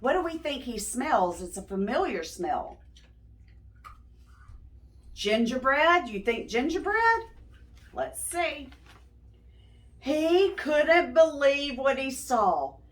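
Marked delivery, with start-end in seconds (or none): 0.0-8.7 put-on bright questions to the young listeners; asking the listening kids to guess, bright; talking to children. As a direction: coax the kids to guess along.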